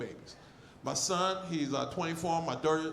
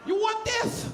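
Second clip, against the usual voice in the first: goofy voice; 11 semitones higher, slower than usual. Retold or imitated. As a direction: ham it up, high and silly.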